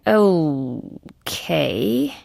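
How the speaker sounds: long drawn-out, doubtful okay; dubious okay. Acted. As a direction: stretch it out, rising into a question. Baffled reaction to an answer.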